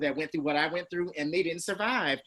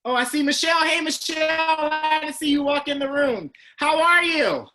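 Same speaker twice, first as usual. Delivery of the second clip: excited greeting; 10 semitones higher, 6 dB louder than usual. Real, not acted. Spots Michelle joining the call.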